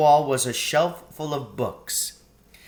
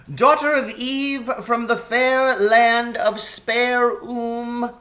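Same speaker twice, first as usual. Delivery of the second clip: a courtly faun inviting a guest to tea; high ceremonious faun; grand faun; 10 semitones higher, 4 dB louder than usual. Acted. Faun character voice.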